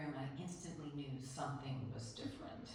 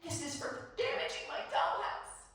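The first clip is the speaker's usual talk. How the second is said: loud, high vengeful yell; acted-out furious child's scream; screamed back; 11 semitones higher, 8 dB louder than usual. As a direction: yell it high at your brother. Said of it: "and I scream back".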